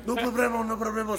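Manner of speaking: a hint of a fisherman reassuring castaways; lightly voiced Mexican fisherman. Mexican rescuer answers in Spanish.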